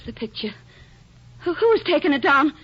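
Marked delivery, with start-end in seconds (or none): 1.4-2.5 alarmed. Acted. Wife to husband about the picture, frightened and loud. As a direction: voice jumps up, louder, frightened questions.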